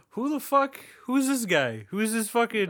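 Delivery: mock bewilderment at a stranger; stunned. Firefighters facing Michael, stunned. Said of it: "Are stunned".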